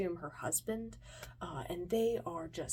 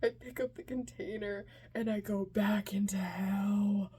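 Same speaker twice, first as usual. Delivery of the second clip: ordinary delivery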